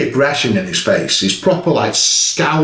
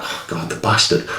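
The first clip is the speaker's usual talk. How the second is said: whispered curse; 8 semitones lower, 5 dB quieter than usual. Retold or imitated. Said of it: "I thought".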